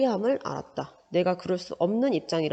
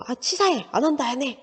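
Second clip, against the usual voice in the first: much higher, petulant whine; sulky huff; 6 semitones higher, 4 dB louder than usual. Retold or imitated. Teacher acting a sulking child who quits in a huff, petulant.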